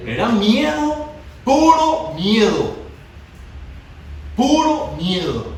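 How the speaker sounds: Puro miedo stretched out slowly; slow, heavy stress on puro; emphatic preaching. Acted. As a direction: drive home puro miedo. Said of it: "hammers the word miedo".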